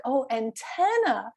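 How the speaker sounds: ANTENNA said an-TEN-na, middle syllable stressed; an "oh" of realization, then weight on TEN in antenna; corrected stress. Retold or imitated. Shopper at last understanding antenna, stress on TEN. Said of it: "just that difference between antenna and antenna".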